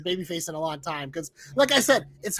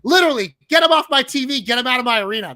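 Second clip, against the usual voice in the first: higher, louder exasperated rant; fed-up shout; 6 semitones higher, 8 dB louder, faster than usual. Real, not acted. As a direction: shout them off the screen in disgust. Ranting at a bad stable.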